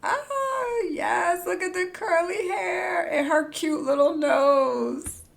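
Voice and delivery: high, gushing delight; delighted gushing cry; excited squeal. Real, not acted. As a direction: squeal over the cute face. Gushing over character creator.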